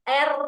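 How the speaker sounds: emphatic drilled R; trilled R. Shown as a demonstration. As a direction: hold a firm trill on the R.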